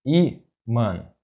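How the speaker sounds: ímã split in two, í - mã; syllable by syllable. Shown as a demonstration. Teacher breaking down an example word, ímã in separate syllables.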